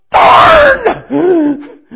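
high, loud hammed-up cry; mock frustrated shout. Preacher hamming up a frustrated cry to the congregation, high and loud. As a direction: yell it like a cartoon groan.

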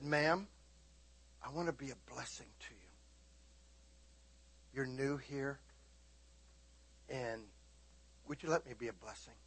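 very soft, low, tender; quiet, reassuring appeal to a stranger; gentle sincere offer. Retold or imitated. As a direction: offer kindness hushed, with long pauses. Retelling tender offer to the woman.

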